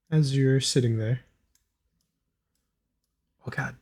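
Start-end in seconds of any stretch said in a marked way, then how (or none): 3.4-3.7 hushed whispered aside; whispering. Real, not acted. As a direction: whisper it under your breath. Aside while fixing the sound.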